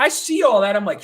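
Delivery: ordinary talk, nothing marked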